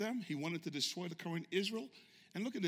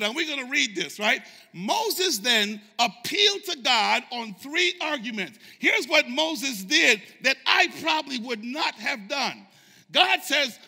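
raised, driving sermon voice; full-force preaching; 7 semitones higher, 14 dB louder than usual. Acted. Preacher telling the congregation what Moses did, full-force preaching. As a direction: preach it hard with punched key words.

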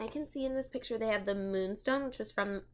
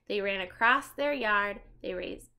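usual speaking voice

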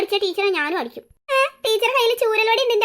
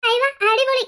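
higher-pitched burst of delight; 4 semitones higher than usual. Acted. Child hearing of a trip to the river, delighted. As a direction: exclaim with childlike excitement.

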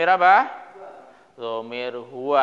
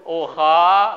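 ordinary talk

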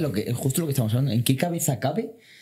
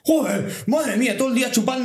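mock fan complaint; 8 semitones higher, 3 dB louder than usual. Bar crowd turning on Vinicius.

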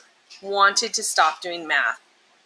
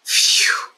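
relieved phew; 3 dB louder than usual. Acted. Relieved character after buttering bread.

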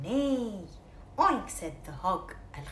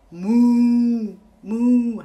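dog mooing; 4 semitones higher, 15 dB louder than usual. Acted. Dog moos in story.

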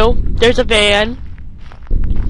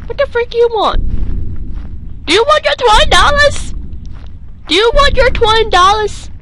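tough guy voice; 10 semitones higher than usual. Pretend. A gamer taunting Slenderman in a hammed-up tough voice. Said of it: "I should not be talking like this".

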